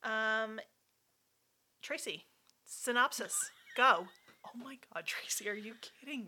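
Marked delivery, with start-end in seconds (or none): 4.5-6.3 exasperated whisper. Real, not acted. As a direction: breathe it out in disbelief. Exasperated aside at Tracy.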